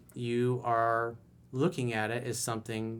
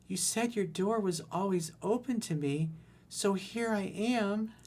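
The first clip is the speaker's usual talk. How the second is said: coy ex's voice; 7 semitones higher, 4 dB quieter, slower than usual. Retold or imitated. Ex showing up at night.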